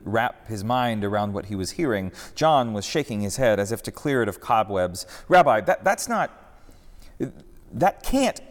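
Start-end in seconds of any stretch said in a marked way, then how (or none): 5.3-8.4 high, loud, broken-off stammer; sputtering in shock. Acted. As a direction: trip over the words in disbelief. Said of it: "he sputtered for a moment".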